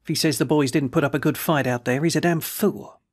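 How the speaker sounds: usual speaking voice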